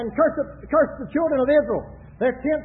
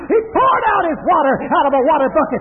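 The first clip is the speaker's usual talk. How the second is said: raised, shouted sermon delivery; full-force preaching; 3 semitones higher, 6 dB louder than usual. Acted. Preacher pictures Israel's tents for the congregation, full-force preaching. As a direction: preach it out with long, cried-out openings.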